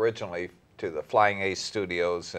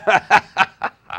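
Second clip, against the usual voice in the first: big laugh; 11 semitones higher, 9 dB louder than usual. Real, not acted. Teasing about the long sitting.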